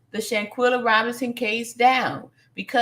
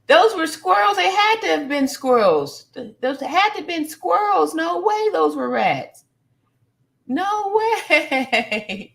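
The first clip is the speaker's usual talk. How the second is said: high, insistent disbelief; incredulous denial; 6 semitones higher, 3 dB louder than usual. Real, not acted. Host insists to viewers those were squirrels, incredulous denial. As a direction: protest it in a raised pitch, refusing to believe. Refuses to believe they were rats.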